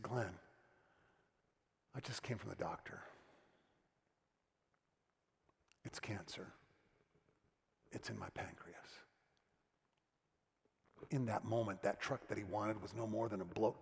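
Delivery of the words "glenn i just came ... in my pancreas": low, soft and grave, each word weighed; grave, serious tone. Retold or imitated. Pastor friend tells Glenn of his cancer, hushed and grave. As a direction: friend breaking bad news, quiet and serious. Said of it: "there's a seriousness of tone, an urgency".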